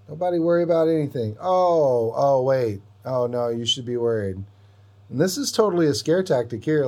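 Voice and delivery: sudden rush of alarmed excitement; excited alarm. Real, not acted. Alarm then excited analysis.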